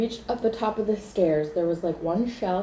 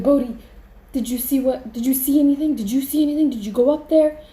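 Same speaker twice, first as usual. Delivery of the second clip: high, loud, panicked demands; frantic questioning; 7 semitones higher, 6 dB louder, faster than usual. Retold or imitated. Asking Bodhi what was seen, frantic questioning.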